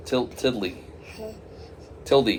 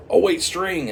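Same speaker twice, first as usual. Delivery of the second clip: high-pitched mock-urgent interjection; sudden correction; 8 semitones higher, 3 dB louder than usual. Customer changing his new name too late, urgent blurt.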